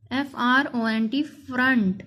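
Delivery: usual speaking voice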